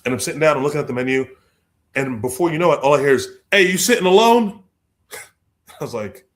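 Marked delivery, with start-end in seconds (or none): 3.5-4.6 calling out. Retold or imitated. Big strangers calling out.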